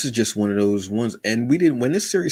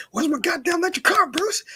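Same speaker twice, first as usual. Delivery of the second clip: much higher, squealing Joker demand; Joker impression. A fan re-quoting Joker demanding his car, shrill squeal. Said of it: "when he goes".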